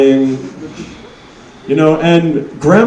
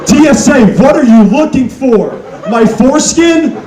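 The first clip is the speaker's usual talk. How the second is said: ranting shout; 10 semitones higher than usual. Acted. Ranting at airport security.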